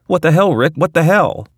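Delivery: high, loud panicky yelp; panicked Morty. Retold or imitated. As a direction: shriek like an overwhelmed teen boy. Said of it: "stammeringly overwhelmed".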